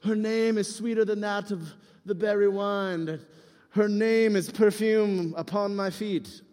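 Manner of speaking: slow, drawn-out mock love poem; romantic poetry recitation. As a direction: recite it with exaggerated, measured romance. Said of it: "just start reading out Song of Songs".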